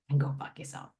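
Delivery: low, unvoiced mutter; hushed, low aside; under the breath. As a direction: slip it out softly under your breath. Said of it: "under my breath, I say".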